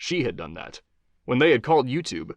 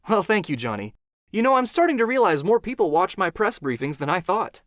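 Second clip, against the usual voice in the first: higher, playful guest voice; Stacy's joke; 8 semitones higher than usual. Acted. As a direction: answer with a light joke, higher. Stacy joking reply.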